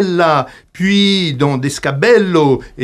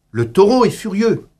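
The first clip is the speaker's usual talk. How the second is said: ordinary talk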